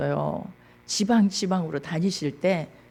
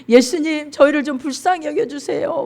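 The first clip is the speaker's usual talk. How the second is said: higher-pitched earnest begging; acted pleading; 7 semitones higher than usual. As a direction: plead like a supplicant asking for mercy. Blind men begging Jesus, acted. (높은 목소리로 애원하듯)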